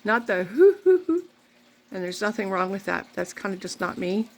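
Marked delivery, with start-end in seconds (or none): 0.0-1.2 mock wailing hoo hoo, pitched high and loud; hammed-up boo-hoo crying; mock sobbing. Shown as a demonstration. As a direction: act out a big sob. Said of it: "I started to cry".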